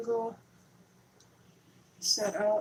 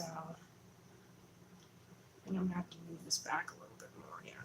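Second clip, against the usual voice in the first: whispered aside; 4 semitones lower, 18 dB quieter, faster than usual. Real, not acted. Whispering while adjusting camera.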